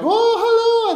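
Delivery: squealed high, gushing hello; high-pitched starstruck hello; gushing fan greeting. Retold or imitated. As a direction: gush at a celebrity like a starstruck fan. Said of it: "people are like".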